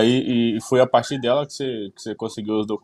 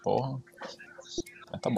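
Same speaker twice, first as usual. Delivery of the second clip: whispered aside; 12 dB quieter than usual. Real, not acted. Grumbling aside.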